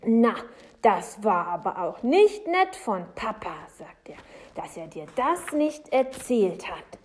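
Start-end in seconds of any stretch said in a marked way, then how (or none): 0.0-3.5 loud, put-on indignation; trying to sound indignant. A narrator's reading. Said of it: "ganz empört zu klingen". 4.6-7.0 raised, put-on indignation; trying to sound indignant. A narrator's reading. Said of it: "ganz empört zu klingen".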